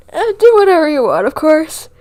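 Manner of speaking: words stretched by a yawn. Real, not acted. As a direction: talk through a big yawn. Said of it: "I don't know why I'm yawning".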